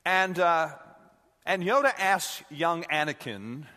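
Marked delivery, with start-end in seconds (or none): none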